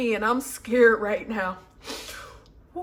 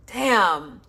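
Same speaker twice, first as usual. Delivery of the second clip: usual speaking voice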